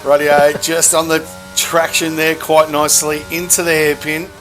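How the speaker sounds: ordinary talk, nothing marked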